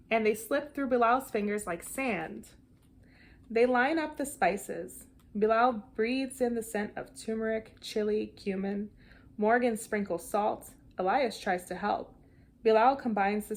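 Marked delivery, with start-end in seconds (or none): none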